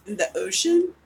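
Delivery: usual speaking voice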